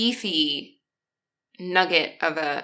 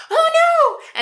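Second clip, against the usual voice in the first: sudden high shriek of alarm, much louder; shocked cry; 9 dB louder than usual. Retold or imitated. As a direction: yelp it out in panic at the thump. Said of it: "all he heard was me go".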